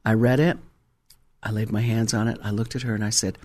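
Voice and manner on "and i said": usual speaking voice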